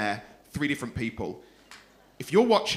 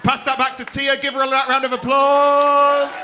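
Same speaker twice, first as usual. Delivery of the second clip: high, loud, drawn-out applause cue; rousing host call; 7 semitones higher, 7 dB louder than usual. Real, not acted. Host sends the card back to the audience winner, rousing call. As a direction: whip the crowd up, stretching the last word.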